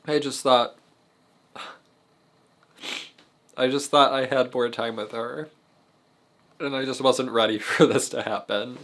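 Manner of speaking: crying while forcing the words out; ugly crying. Real, not acted. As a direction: voice breaking through sobs. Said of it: "me ugly crying in a video".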